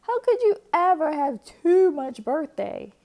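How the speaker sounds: incredulous and scornful; how could anyone think that; scoffing. A narrator's reading. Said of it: "scoffed Papa and the Cubs".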